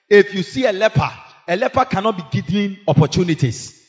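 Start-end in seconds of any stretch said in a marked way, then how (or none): none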